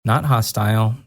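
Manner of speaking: ordinary delivery, nothing marked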